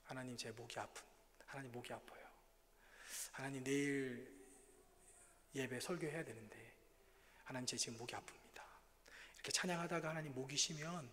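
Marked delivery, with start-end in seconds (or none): 0.0-8.6 quiet prayer. Retold or imitated. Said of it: "찬양을 드리지 못하는 거예요".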